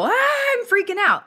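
high-pitched mock panic; kid freaking out. Kid copying the adult's fright at a spider, high mock panic. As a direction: squeal it like a panicking child. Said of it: "what's the kid gonna do?".

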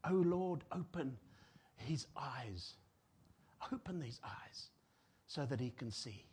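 soft, low whispered prayer. Real, not acted. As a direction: pray it in a hushed whisper.

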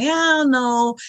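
high-pitched retold hesitation; wavering. Host retelling her wavering over publishing, high-pitched and unsure.

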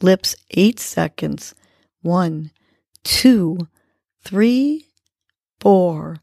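calm, steady counting for breathwork; guided breathing count. Acted. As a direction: count the breaths gently and evenly. Leads counted breathing exercise.

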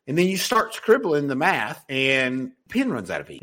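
normal talking voice

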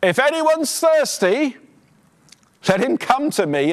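high, ringing cry out to the crowd; loud call. Retold or imitated. Pastor reading Jesus calling out at the feast, loud and high. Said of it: "said in a loud voice".

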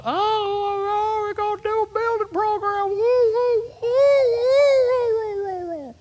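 very high mock squeal; mock the congregation in a shrill falsetto; mocking churchgoer. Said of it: "some of y'all going".

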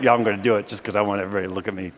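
fast, offhand boast; mock bravado. Congregation member boasting, fast and offhand. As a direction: rattle it off as a show-off. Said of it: "I know you're like".

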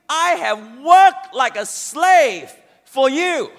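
slow, loud and bitter; resentful. Acted. Son resenting his father, slow and loud. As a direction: lean hard on the complaint. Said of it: "dripping with resentment".

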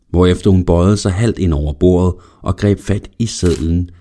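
ordinary delivery

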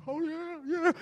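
mimicked high-pitched hype; friend's hype catchphrase. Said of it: "he would do this thing like this".

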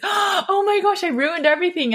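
high-pitched, horrified waking cry; hammed-up panic, as if jolted from a nightmare; mock panic. Dieter waking from a cake-binge dream, mock panic. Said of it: "I would wake up with this".

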